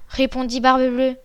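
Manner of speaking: usual speaking voice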